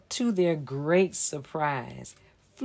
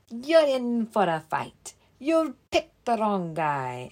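higher, louder bellow of a challenge; 4 semitones higher, 5 dB louder than usual. Acted. Leprechaun bellowing a challenge at the bunny. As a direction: bellow out a fight threat. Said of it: "The leprechaun bellowed".

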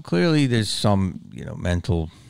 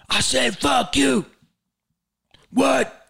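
retold shouting match; much slower than usual. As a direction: bark it back at him in the street. Speaker shouting in the confrontation.

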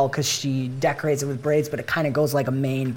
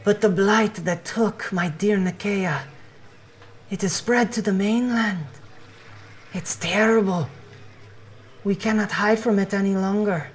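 put-on higher-pitched, accented doomsday evangelist; fervent creature missionary in a raised voice; earnest missionary; 6 semitones higher than usual. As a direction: spread the word with solemn urgency. GM plays Bohan missionary.

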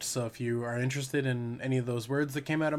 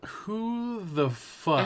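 very slow, drawn-out, higher pitch; mock disbelief; 7 semitones higher, much slower than usual. Host teasing about famous singers, mock incredulous drawl. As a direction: stretch every word out in mock outrage. Mock repeat of earlier question.

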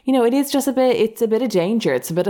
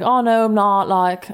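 higher, half-hearted mock English accent; rough English accent; 4 semitones higher, slower than usual. Catfish denying he is English, rough mock English accent pitched up. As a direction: start a hammed-up English denial. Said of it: "I'm actually gonna try to do it".

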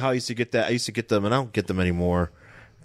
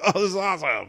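excited; 8 semitones higher, faster than usual. Retold or imitated. Retelling excitement over a card.

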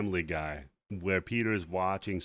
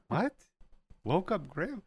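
higher-pitched incredulous echo; mock bafflement; 8 semitones higher than usual. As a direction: question it in playful disbelief. Host acts baffled reaction to line.

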